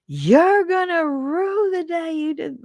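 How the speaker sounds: high, slow, hammed-up revenge voice; mock vengeful threat. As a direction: ham up a scorned woman's threat. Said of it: "I don't do the".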